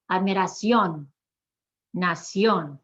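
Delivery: "Admiración, nación" each stressed on the final CIÓN; last-syllable stress. Shown as a demonstration. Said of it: "emphasize the last syllable".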